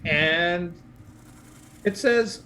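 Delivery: ordinary talk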